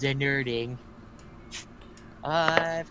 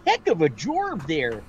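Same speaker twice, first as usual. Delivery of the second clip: Coach Z; 4 semitones higher, 7 dB louder than usual. Acted. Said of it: "Coach Z".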